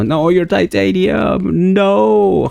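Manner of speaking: high, slow drawn-out whine; disappointed whine. Real, not acted. Miner finding titanium instead of copper, whining. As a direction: let the letdown stretch the words. Ore turns out wrong type.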